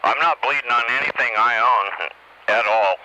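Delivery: ordinary talk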